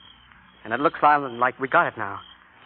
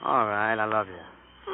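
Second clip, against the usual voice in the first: softer, lower, embarrassed I love you; grudging mumble; 8 semitones lower, 6 dB quieter, much slower than usual. Acted. Joe tells Mabel he loves her, sheepish mumble. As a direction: mumble it like you're ashamed. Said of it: "don't sound so ashamed".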